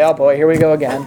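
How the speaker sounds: low, weary sarcastic sigh of a line; mock eye-roll. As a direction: drop the voice and sound fed up. Mocking eye-rolling listener.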